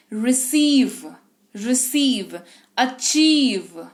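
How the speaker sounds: रिसीव and अचीव with "ee" held long; lingering long ee. Shown as a demonstration. Teacher showing the vowel lingers, long held "ee". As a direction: slowly stretch the "ee" in रिसीव, अचीव. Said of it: "यहां पर देखो ठहराव ज्यादा है".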